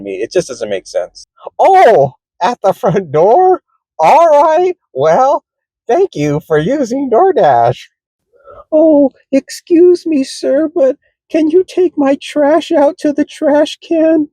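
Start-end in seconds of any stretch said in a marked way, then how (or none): 1.6-7.9 high, cheerful phone voice; voicing a chirpy customer. Acting customer call reply. 8.7-14.3 much higher, slow, politely demanding; high customer voice. Retold or imitated. Customer asking a favor.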